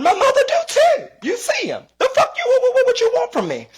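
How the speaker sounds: high mock-outraged squeal; exasperated shriek. Real, not acted. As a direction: screech it out in disbelief.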